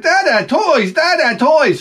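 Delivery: high-pitched imitation of an eager child; excited little boy. Small son to dad at garage sale, imitated excited child. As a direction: mimic a kid clamoring for attention.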